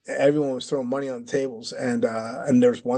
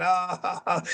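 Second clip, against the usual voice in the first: higher mock crowd laugh; imitated laughter; 5 semitones higher than usual. Everybody at the table laughing at the slap, imitated laughter. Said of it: "everybody was laughing".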